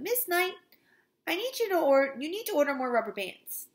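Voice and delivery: high-pitched office summons; calling out. A narrator's reading. Principal buzzing the secretary for supplies, calling out. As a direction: call through to the secretary.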